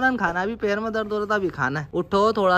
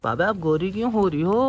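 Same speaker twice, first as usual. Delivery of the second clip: ordinary delivery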